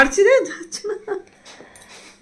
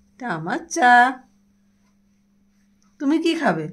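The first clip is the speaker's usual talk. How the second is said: ordinary delivery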